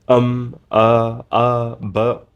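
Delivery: hesitant um and uh; filler words. Shown as a demonstration. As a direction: stumble with empty fillers. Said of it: "with empty words like".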